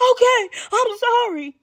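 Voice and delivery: put-on very high, pleading apology of a beaten boy; mock pleading. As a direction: beg like the boy getting beaten downstairs. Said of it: "All I heard was".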